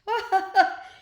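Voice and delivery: high, loud cackle; old man laugh. A narrator's reading. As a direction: laugh even harder. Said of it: "made him laugh more".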